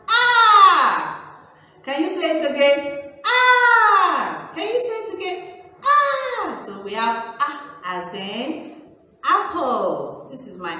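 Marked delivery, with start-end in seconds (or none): 0.1-1.4 high, loud sung-out "aah"; long play-acted cry of alarm; mock scream. Cry ending the ants song. 3.2-4.5 high, loud held "aah"; long play-acted cry to be copied; mock scream. Shown as a demonstration. Repeats cry for children to copy. 5.8-6.6 very high held "aah"; squealed play-acted cry; mock scream. Shown as a demonstration. Repeats cry for children to copy.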